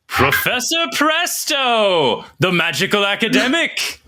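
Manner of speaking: very high, slow showman announcing the name; grand stage name announcement. Acted. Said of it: "I love it".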